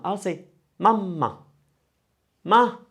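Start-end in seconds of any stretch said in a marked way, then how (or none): 2.4-2.8 ma punched higher and louder; stressed first ma. Shown as a demonstration. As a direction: hit the ma hard. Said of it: "pick a lot more with the vowel".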